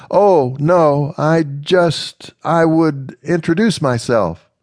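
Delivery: halting, unsure start; nervous. Acted. Amanda introducing herself to the slave woman, mildly nervous. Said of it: "Amanda said nervously".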